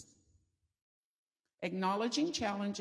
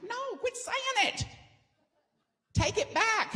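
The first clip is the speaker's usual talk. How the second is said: mock pleading protest; 13 semitones higher, 3 dB louder than usual. Speaker protesting to her coach, high and whiny. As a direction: beg her to take it back. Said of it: "I'm like".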